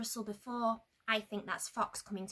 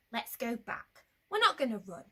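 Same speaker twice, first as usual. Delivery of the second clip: plain reading voice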